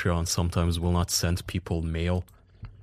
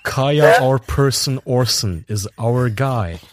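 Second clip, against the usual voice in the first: slow, higher, grandly announced titles; announcing puns; 5 semitones higher, 7 dB louder, slower than usual. Acted. Host reading a listener's terrible Kaya puns as grand announced titles. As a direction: proclaim each pun like a royal title.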